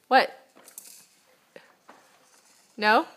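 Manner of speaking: usual speaking voice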